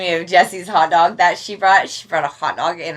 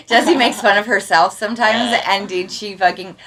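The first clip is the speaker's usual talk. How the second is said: normal talking voice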